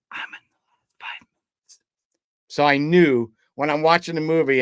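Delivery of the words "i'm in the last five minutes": very soft, slow whisper; whispered secret. Retold or imitated. Actor whispers a secret wedding gift in a fan's ear, hushed whisper. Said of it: "he whispered in my ear".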